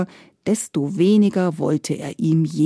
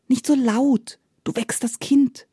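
ordinary delivery

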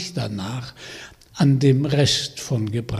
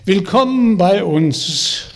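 calling out; 6 semitones higher, 6 dB louder than usual. Acted. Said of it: "rief der Wassermannvater".

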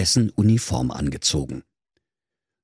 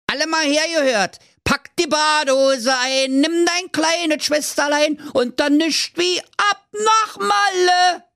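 high squeaky mother goat. Acted. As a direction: Berlin mother goat calling her kids. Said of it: "Mutter Geiß sprach".

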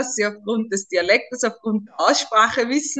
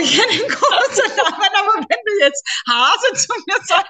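high, embarrassed giggly voice, slowing down; laughing; 11 semitones higher, 4 dB louder than usual. Real, not acted. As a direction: laugh it out, a bit shy. Embarrassed about pet name Hase. (verlegen lachend, höher)

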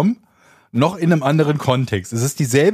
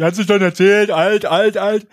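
louder, higher, chanted mock complaint; mock complaining listener; 6 semitones higher, 4 dB louder than usual. Co-host imitates listeners griping about old stories, mocking. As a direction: parody a heckler's repeated gripe. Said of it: "bevor ihr sagt".

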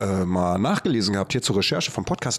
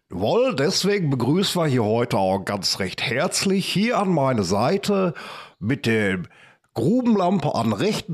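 drawn-out showman announcing a guest, pitched up; hammed-up host intro; 6 semitones higher, slower than usual. Radio host hyping his co-host to listeners, hammed-up announcer voice. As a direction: roll out the welcome like a radio host.

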